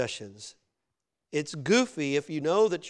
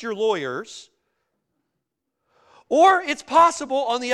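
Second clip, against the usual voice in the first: raised, louder preacher's delivery; forceful preaching; 8 semitones higher, 9 dB louder than usual. Real, not acted. Preacher warns congregation about hoarding or losing money, forceful. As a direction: preach it out with punch.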